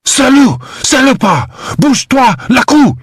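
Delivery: woman screaming at intruders in rapid French. A narrator's reading. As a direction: shriek it fast at the fleeing men. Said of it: "screaming in rapid French".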